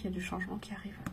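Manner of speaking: hushed unvoiced whisper. Real, not acted. Reader confiding the coming change in a whisper.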